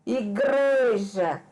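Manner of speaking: "Igreja" very slow, GRE held long; teacher's stretched "Igreja", leaning on GRE; stress on the tonic syllable. Shown as a demonstration. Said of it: "a sílaba que eu pronuncio com mais intensidade".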